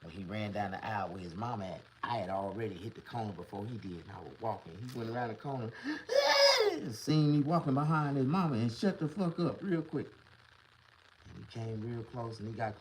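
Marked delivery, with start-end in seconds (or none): 5.8-6.7 loud high sharp gasp; a shrieking gasp of a scared kid; startled gasp. Retold or imitated. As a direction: gasp in sudden fright. Kid sees him.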